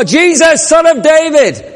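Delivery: high, loud desperate cry; calling out. Acted. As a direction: cry out to be heard over the crowd. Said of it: "he called out even more".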